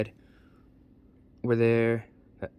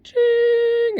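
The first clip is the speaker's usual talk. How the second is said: piercing, loud, drawn-out imitated chime; high ringing "ching"; 10 dB louder than usual. The girl joining the song, a high loud ring.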